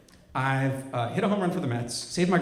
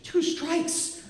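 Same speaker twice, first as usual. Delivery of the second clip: pitched far up, tense mock alarm; imitating a desperate mother; high desperate warning. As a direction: squeal the warning like it's a disaster.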